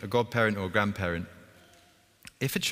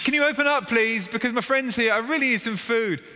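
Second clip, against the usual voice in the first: higher, louder pleading call through the door; urgent plea; 13 semitones higher, 5 dB louder than usual. Acted. Villager knocking again at midnight, high urgent begging. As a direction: beg again, more desperate.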